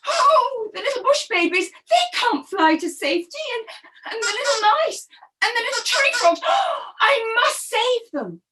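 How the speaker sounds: high, urgent parrot voice rising in worry; alarmed parrot. Acted. Parrot panicking over the trapped animals, high and urgent. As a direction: fret for the little ones, then resolve.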